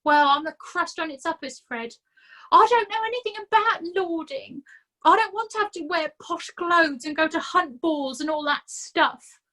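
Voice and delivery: self-pitying complaint; whining Nobby. A narrator's reading. Nobby complaining to Fred about his bad luck, whining.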